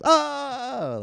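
high, loud crowd oh; a whole room's mock gasp of delight; class going oh. Class reacting to the teacher's burn, high loud oh.

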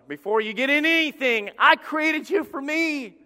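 high, loud proclamation, stretched at the end; impassioned God. Acted. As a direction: declare it as God claiming his creation. God voiced claiming Adam.

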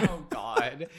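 talking through real laughter; laughing. Real, not acted. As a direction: plead while cracking up. Cracking up at the bad accent.

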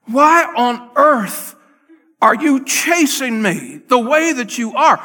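high, loud, desperate plea; hollering out. Retold or imitated. David hollering at Saul across the gorge, desperate loud plea. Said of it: "David is hollering out".

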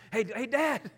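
playful imitation of an interrupting son; imitated chatty son. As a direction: mimic a child butting in, laughing.

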